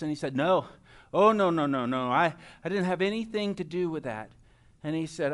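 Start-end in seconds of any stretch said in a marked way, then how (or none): 0.4-4.3 loud, falling string of denials; mock-innocent kid protesting; hasty denial. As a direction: deny it a bit too much. Child denying blame to his dad.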